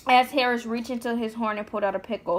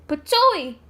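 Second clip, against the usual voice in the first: spitting patooey; 6 semitones higher, 7 dB louder than usual. A narrator's reading. Spitting sound in story.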